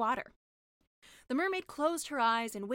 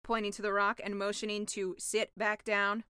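ordinary delivery

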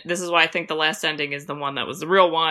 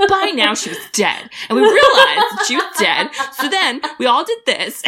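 imitating Tim Curry; 5 semitones higher, 4 dB louder than usual. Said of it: "he's like".

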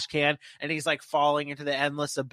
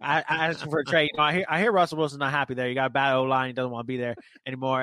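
put-on fast, lower, rambling Boomhauer-style Texan accent; Jerry Jones Boomhauer accent; 3 semitones lower than usual. Pitching a trade to a co-host as a rambling Boomhauer-accented owner. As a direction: rattle it off fast, low, in a loose Boomhauer Texas accent. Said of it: "Jerry Jones has a Boomhauer accent from King of the Hill".

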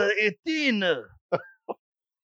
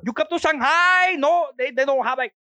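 mock Chinese accent; 6 semitones higher than usual. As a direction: ham up a Chinese-accented shopkeeper. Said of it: "when he's doing Korea or China".

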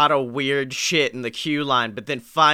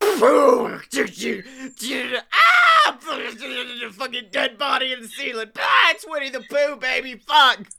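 high, fast shrieking panic; panicked scream; 10 semitones higher than usual. Acted. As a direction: shriek in comic terror. Mock shriek at dead body.

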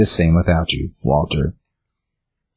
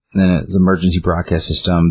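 usual speaking voice

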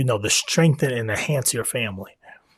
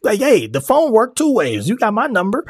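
much higher and louder, exasperated retort; indignant outburst; 10 semitones higher, 4 dB louder than usual. Real, not acted. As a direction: fire it back, fed up with the accusation.

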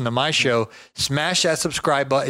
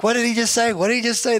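1 baffled question; 8 semitones higher, faster than usual. Retold or imitated. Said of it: "Their accent's so thick".

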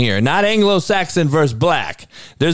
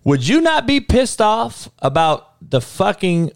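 heated; 6 semitones higher than usual. Real, not acted. Heated rant about four hundred years.

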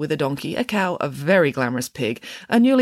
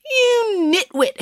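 high, drawn-out insult; outraged accusation; 4 dB louder, much slower than usual. A narrator's reading. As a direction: stretch the insult out at high pitch. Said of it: "accused Judith Piggins".

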